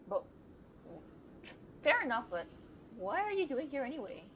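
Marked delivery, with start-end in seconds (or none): none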